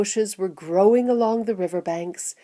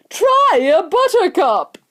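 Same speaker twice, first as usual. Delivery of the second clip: loud, high, gleeful call; excited cry; 12 semitones higher, 6 dB louder than usual. A narrator's reading. Wonka urging visitors to taste his flowers, excited cry. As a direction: call it out like an eager showman. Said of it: "cried Mister Wonka".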